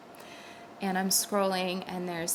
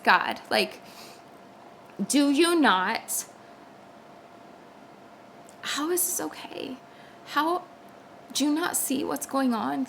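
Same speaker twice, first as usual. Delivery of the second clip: anguished cry to God; 5 semitones higher than usual. Retold or imitated. Crying out to God in distress, high anguished plea. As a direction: cry out to God, voice lifted and straining.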